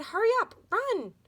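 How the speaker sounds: high, urgent hurry. A narrator's reading. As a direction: push her to run, fast and high. Tiffany urging escape.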